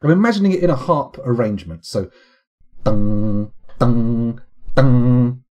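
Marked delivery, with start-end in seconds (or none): 2.8-5.4 loud, monotone "dung" string imitation; flat, bland plucks. Shown as a demonstration. Streamer imagining a plucked harp arrangement, flat monotone dungs. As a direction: sound three identical bland string plucks. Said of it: "Very flat, very bland".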